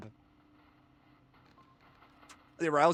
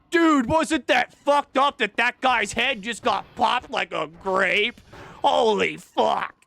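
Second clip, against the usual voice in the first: slow, gushing mock gamer awe, higher and louder; gore-gushing gamer; 6 semitones higher, 4 dB louder, slower than usual. Mimicking gamers who gawk at a popped head, slow mock awe. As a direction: drag it out like a gamer marveling at gore.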